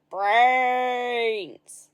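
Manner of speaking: long, loud drawn-out howl of brains; loud zombie wolf howl. A narrator's reading. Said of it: "the wolves howled".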